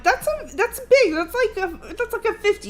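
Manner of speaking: very high, squeaky-pitched reaction; high-pitched exclamation. Retold or imitated. Wife reacting to his forty-fifth birthday, high-pitched exclamation. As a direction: jump way up in pitch on the milestone.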